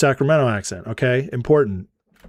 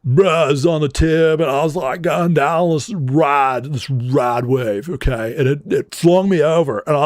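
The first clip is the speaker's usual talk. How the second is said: hammed-up surfer accent, lazy long vowels; Surfer Bro accent. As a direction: tell it like a stoked California surfer dude. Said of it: "I'm not Surfer Bro either".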